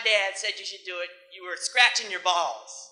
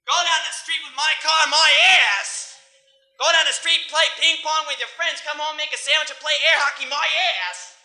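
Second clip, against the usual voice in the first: loud, high ranting father; hammed-up tirade, blasting each sign-off; mad dad; 5 semitones higher, 7 dB louder than usual. Father chewing out his kid, loud mock-angry rant. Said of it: "When my dad got mad".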